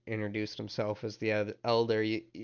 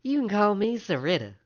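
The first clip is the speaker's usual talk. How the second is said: high-pitched, forward character voice; woman at the desk; 11 semitones higher, 6 dB louder than usual. Acted. Woman gives her name to the visitor, high and forward. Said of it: "woman probably about the, the same age".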